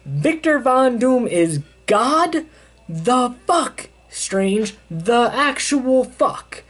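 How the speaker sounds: slow, high-pitched incredulous outrage; Reed pissed off. Retold or imitated. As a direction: stretch each phrase out in acted angry disbelief. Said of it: "But then Reed gets pissed".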